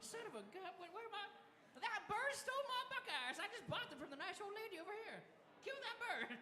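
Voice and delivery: put-on high-pitched little old lady, indignant. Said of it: "The old lady turns around".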